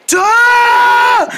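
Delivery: high shouted syllable; loud shout. Acted. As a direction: blurt it out at the top. Vomiting scene.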